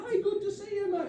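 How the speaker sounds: tiny, high, soft greeting; excited driver at the start. Retold or imitated. Driver at the start of his decline, high and eager. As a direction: chirp a hello, high and light. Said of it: "'cause it started here".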